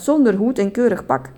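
usual speaking voice

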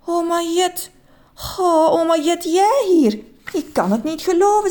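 high, joyful gasp; can't believe it's her; overjoyed disbelief. A narrator's reading. Man marvelling at his grandma, high and overjoyed. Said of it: "zei meneer Just".

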